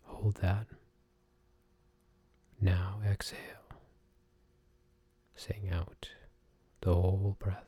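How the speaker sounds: hushed, soft relaxation whisper; soft spa whisper. Acted. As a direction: breathe the words out softly.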